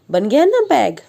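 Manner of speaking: normal talking voice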